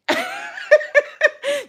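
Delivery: real burst of high laughter; cackling at her own threat; loud high laugh. Real, not acted. Big laugh after threat.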